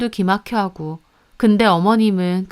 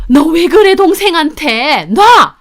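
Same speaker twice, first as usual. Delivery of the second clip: screaming protest; 7 semitones higher, 8 dB louder, slower than usual. Acted. Sister screaming while dragged out.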